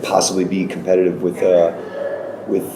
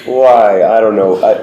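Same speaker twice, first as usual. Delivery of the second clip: laughing; 2 semitones higher, 6 dB louder, slower than usual. Real, not acted. Laughing reply to touring question.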